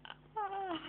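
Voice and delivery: high delighted "ah"; excited gasp. Real, not acted. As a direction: gasp with joy at the number. Excited at view count.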